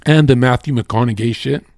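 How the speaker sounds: normal talking voice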